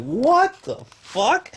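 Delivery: high, slow stunned disbelief; stunned what the fuck. Retold or imitated. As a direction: drag out the shock, voice way up. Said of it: "he was just like".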